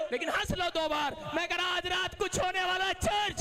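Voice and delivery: high, loud, shouted preaching; preaching at full force. Acted. Preacher urging the congregation to laugh in joy. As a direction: fire up the church to rejoice.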